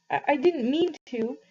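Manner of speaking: a touch of a tearful blurt. A narrator's reading. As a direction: let it burst out. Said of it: "he finally blurted out".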